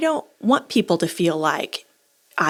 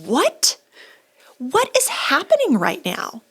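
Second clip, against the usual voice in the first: high, slow stunned disbelief; mock bewildered what; 7 semitones higher, slower than usual. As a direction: rise up in shocked confusion. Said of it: "I'm just like".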